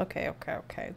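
whispering. Real, not acted. Whispered to herself.

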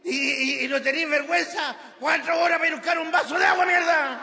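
high, loud, rushing rant; hammed-up angry tirade; furious acted scolding. Acted quarrel between the couple.

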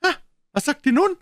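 put-on Aunt Polly triumphant at the children. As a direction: crow in triumph. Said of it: "triumphierte Tante Polly".

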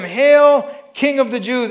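mock hail, loud and jeering; taunting. A narrator's reading. Soldiers taunting Jesus, loud mock salute. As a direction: sneer it like a soldier mocking a king. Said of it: "taunted him".

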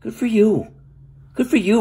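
high, mocking "good for you"; sarcastic reply to smug non-fans. Real, not acted. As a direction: brush them off with sarcasm.

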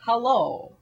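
put-on soft, slow Russian-accented Putin; quiet plea in a measured Russian accent. Putin sticking up for his good friend, soft Russian accent. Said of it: "good old Vladdy is like".

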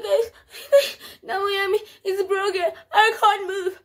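high, loud, tearful wail; hammed-up sobbing plea; mock crying. Telling the cousins she can't move, high mock sobbing. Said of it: "I'm crying the whole time".

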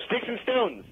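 high-pitched schoolyard team call; game yell. Shown as a demonstration. Prompting a friend to answer the rhyme, high mock yell. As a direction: sing out the cue high, without real volume. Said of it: "So I'm gonna yell".

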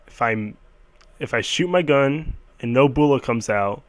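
usual speaking voice

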